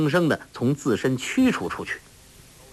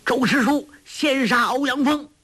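shouting out; 12 semitones higher than usual. Acted. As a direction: yell it across the battle. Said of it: "大叫".

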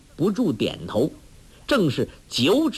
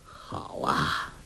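low, soft, seething; suppressed anger; 11 semitones lower, 8 dB quieter than usual. A narrator's reading. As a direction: seethe under your breath. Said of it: "怒火越盛". (压着怒火低声说)

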